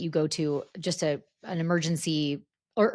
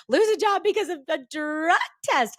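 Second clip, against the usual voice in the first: panicked; 10 semitones higher, 3 dB louder than usual. Retold or imitated. Panicked call to mom.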